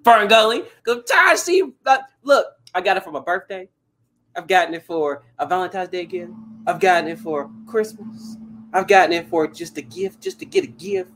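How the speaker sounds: high, loud, laughing complaint; a comic fed-up tirade; exasperated rant. Real, not acted. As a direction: vent about a gift you hate, half joking. Ranting about hated gift.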